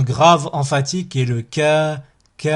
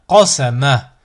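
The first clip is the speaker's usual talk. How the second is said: syllables joined as one word; 6 dB louder, slower than usual. Shown as a demonstration. Teacher models a word for learners to repeat, joined in one go. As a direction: run the syllables together without pauses. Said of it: "ensuite le mot".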